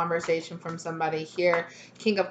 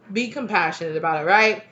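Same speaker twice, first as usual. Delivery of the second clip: normal talking voice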